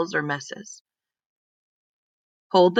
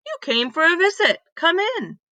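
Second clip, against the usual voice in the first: higher, welcoming neighbour voice; cheerful Miss Reed; 10 semitones higher than usual. Acted. Neighbour invites the two children inside, higher and welcoming. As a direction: welcome them in gladly.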